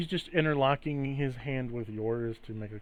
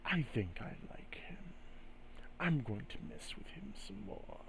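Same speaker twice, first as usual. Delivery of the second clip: voice in the head; 12 dB quieter than usual. Acted. Spirit tells Spirit Leaf it will keep messing with him, whispered. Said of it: "he just says in your head".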